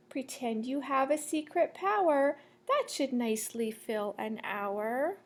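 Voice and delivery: lilting sing-song picture-book read; reading to children. Acted. Teacher reading a rhyming book to the class, sing-song. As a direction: read the rhyme to the class, bouncing.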